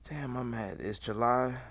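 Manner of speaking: ordinary talk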